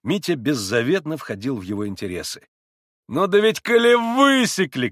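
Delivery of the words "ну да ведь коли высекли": laughing shout. A narrator's reading. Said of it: "крикнул он хохоча".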